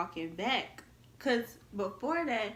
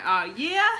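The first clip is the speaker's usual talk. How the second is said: loud, biting "Oh, yeah?"; catching him out, loud and pointed; mock-outraged retort; sarcastic oh yeah; 12 dB louder than usual. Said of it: "I'm like".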